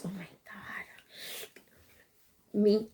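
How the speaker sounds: normal talking voice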